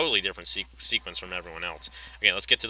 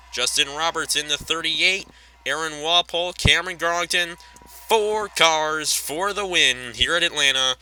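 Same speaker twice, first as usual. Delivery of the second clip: raised, driving race commentary; live race play-by-play; 7 semitones higher, 5 dB louder than usual. Pretend. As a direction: call the lead battle like a track announcer. Resumes race call.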